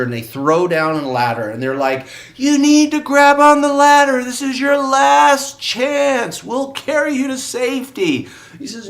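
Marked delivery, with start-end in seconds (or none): 2.4-8.3 high, loud, slow call from above; shouting from helicopter. Retold or imitated. Helicopter crew shouting to the man on the roof: high loud calling. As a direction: yell down over the rotor noise, much higher.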